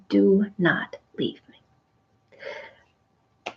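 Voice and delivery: slow, hushed plea; Mary at the risen Jesus' feet, a slow hushed plea; pleading. Retold or imitated. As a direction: beg slowly and softly, clinging to his feet. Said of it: "Is that the way we worship God?".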